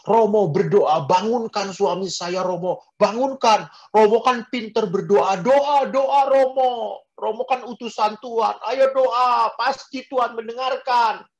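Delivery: high, loud frantic begging; desperate wife pleading. Retold or imitated. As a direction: plead like a grieving wife crying out to the priest. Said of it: "istrinya langsung teriak pada saya".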